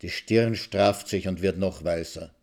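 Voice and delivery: ordinary delivery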